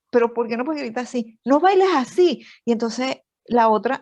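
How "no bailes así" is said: imitating a husband scolding, higher and sharper; mock the dad telling the boy off, pitched up; scolding father. A wife imitates her husband scolding their son, mock stern.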